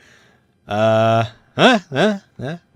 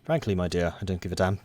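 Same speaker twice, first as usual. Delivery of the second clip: quoting a film line; 4 semitones lower, 7 dB quieter than usual. Retold or imitated. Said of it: "That was the quote".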